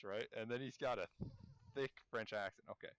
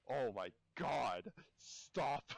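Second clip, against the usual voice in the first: loud, drawn-out wail breaking into laughter; real exasperation, about to crack up; exasperated outcry; 4 semitones higher, 5 dB louder, much slower than usual. Real, not acted. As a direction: cry out slowly, pitch jumping up on "Stop".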